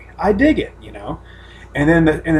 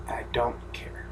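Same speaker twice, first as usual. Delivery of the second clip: whispered aside; 7 semitones lower, 18 dB quieter, slower than usual. Real, not acted. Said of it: "aside after spoiling".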